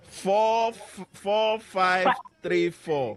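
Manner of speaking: slow readback. Real, not acted. Host reads back caller's number.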